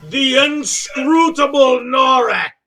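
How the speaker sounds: slow, drawn-out arena announcer; announcer call. Acted. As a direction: stretch the name for the crowd. Announcer introducing fighter.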